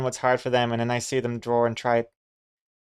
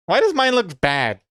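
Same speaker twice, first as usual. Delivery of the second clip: exasperated outburst; 7 semitones higher, 5 dB louder than usual. Real, not acted. Frustrated at drawing.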